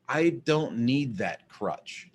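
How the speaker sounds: slow, each word set down deliberately; drawn-out, firm I don't need that crutch; proud declaration. Retold or imitated. Imitating self-made women friends, slow and deliberate. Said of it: "and they say it like that".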